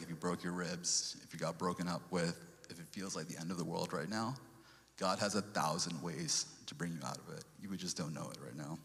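usual speaking voice

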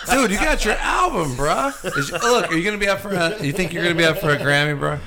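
high, laughing tease about the album; laughing as you tease him. Real, not acted. Teasing friend about his album.

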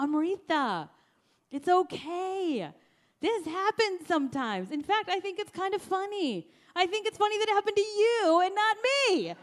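lightly teasing, sing-out reassurance; reassuring husband. Retold or imitated. Husband telling wife running out of gas is okay, amused and reassuring.

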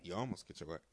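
a lightly dropped voice; low menacing imitation. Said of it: "he didn't have to drop an octave".

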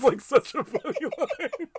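high and loud through real laughter; can't get the words out for giggling; cracking up at a funny movie line; laughing. Real, not acted. Laughs while praising the line.

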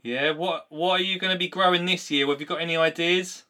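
ordinary talk

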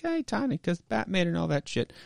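high, soft mock voice; imagined artist's voice. Imagined artist addressing the writer.

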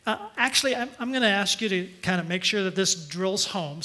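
normal talking voice

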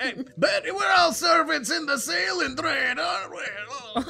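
hammed-up high voice, dissolving into laughter; drunken brother. Drunk brother joking about being servants, high and laughing. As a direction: pitch it high and break up laughing.

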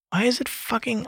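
usual speaking voice

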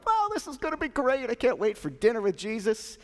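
high, gushing anticipation; giddy, starting very high and easing down; excited disciples. Acted. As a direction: burst in thrilled about dinner. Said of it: "with all that excitement".

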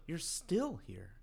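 slow, judgmental remark. Retold or imitated. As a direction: let the judgment drip. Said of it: "when she says".

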